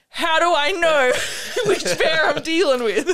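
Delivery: high, loud, laughing through the question. Real, not acted. Asking her co-host which bear it is, laughing.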